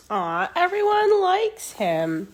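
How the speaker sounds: a high cooing aw, sweet and fond; tender aw. A narrator's reading. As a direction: coo over the little buffalo. Cooing aside within the story.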